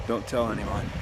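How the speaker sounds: low, soft secretive aside; hushed confession. Real, not acted. Confessing he rakes corn.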